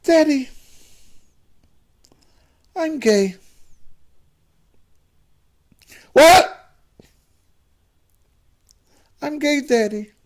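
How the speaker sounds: very high, halting son, sigh included; a son about to come out to his father, very high voice; son's high voice. Acted. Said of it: "why are you talking like that".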